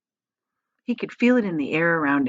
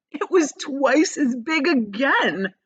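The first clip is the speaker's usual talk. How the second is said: plain reading voice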